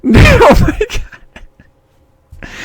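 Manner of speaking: very high, slow strained laugh; disbelieving squealing laugh. Real, not acted. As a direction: laugh helplessly through the words. Laughing at game's darkness.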